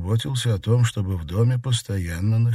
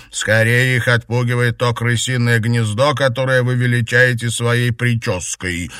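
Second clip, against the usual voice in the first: loud, higher-pitched jibe; quarrelsome comeback; 5 semitones higher, 6 dB louder than usual. A narrator's reading. Maid mocking her mistress's hair, quarrelsome. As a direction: throw the insult straight back. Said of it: "не осталась в долгу горничная".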